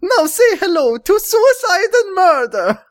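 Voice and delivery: high loud menacing cartoon voice; villain Mario voice. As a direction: threaten like a creepy villain. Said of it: "he said".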